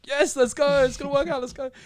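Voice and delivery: high-pitched, giddy cheering; excited celebration. Acted. Player telling parents about the coach meeting, giddy excitement. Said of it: "like, going crazy".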